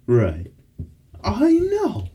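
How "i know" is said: high whimpery coo. Real, not acted. Talking to her dog.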